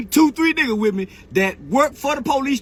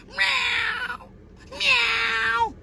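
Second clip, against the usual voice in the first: much higher, drawn-out slow meow; mock cat meow; 3 dB quieter than usual. Mocking Woody's threats as meows, high cat meow. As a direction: whine like a little cat. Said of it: "I hear a cat meowing".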